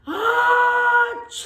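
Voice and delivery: loud high achoo; big sneeze. Acted. Big bear shows how to sneeze, loud achoo. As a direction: let out a huge sneeze. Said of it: "then I sneeze".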